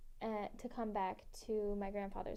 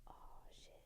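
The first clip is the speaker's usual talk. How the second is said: breathless voiceless whisper, very soft; whispered oh shit; 19 dB quieter than usual. Retold or imitated. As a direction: barely mouth it in shock. Retold reaction in a dark night.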